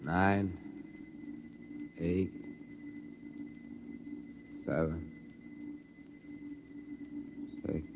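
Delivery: counting backwards, sinking from awake to asleep; drowsy counting. Acted. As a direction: let the count drift off as you go under. Hypnotized man counting into sleep.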